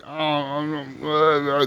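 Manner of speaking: put-on soft, garbled drunken mumble; slurring drunk. Drunk cowboy answering his gang leader, imitated slurring. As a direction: imitate a drunk too far gone to form words. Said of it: "he's all slurring his words".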